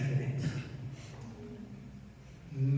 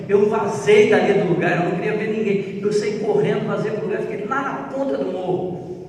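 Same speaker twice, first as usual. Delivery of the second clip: rising excitement; 4 semitones higher, 3 dB louder than usual. Retold or imitated. Reliving overwhelming encounter, building.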